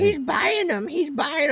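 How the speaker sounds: high whiny taunt; mocking troll voice. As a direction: sneer it like the troll. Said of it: "And then he goes".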